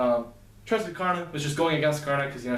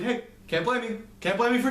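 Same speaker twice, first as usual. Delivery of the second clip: teasing Nermosa; 7 semitones higher than usual. Acted. Nermosa teasing Terra about brother.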